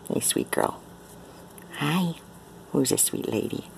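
soft breathy coo; whispering to cat. Real, not acted. Owner whispering sweet nothings to her cat.

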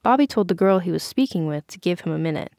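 usual speaking voice

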